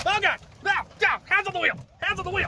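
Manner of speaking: high, loud frantic yelling; real panic, shouting; panicked shouting. Real, not acted. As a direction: scream in alarm at the driver. Panicked shouting during driving clip.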